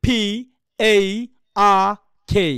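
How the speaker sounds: loud, separated English letters, "আর" as "ah"; native-style spelling. Shown as a demonstration. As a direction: spell it out with spaces, puffed "পি", open "আর" without R. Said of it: "একেবারে এইভাবে বলবে".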